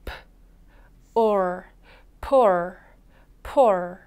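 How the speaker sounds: "poor" built up sound by sound: p, then or, then the whole word twice; 소리를 나눠 보여주는 poor. Shown as a demonstration. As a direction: break "poor" into p and or, then blend it. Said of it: "한 번 더".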